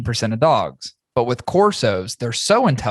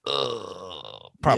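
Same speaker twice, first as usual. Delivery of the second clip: reluctant groan; 5 semitones lower, 6 dB quieter, slower than usual. Retold or imitated. Reluctant reply to pushy guest.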